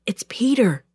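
ordinary delivery

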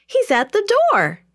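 high, loud excited announcement; joyful shout. A narrator's reading. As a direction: shout the good news to the household. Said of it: "she shouted joyfully".

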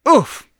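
sudden high, loud oof of a stubbed toe; pained oof. Acted. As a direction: yelp it out as the toe hits something. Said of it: "Father exclaimed".

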